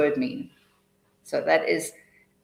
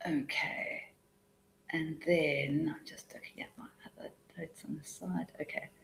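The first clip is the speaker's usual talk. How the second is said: unvoiced murmur to herself while reading notes; whispering while checking notes; whispered aside; 15 dB quieter than usual. Real, not acted. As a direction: drop to a breathy whisper, off-mic.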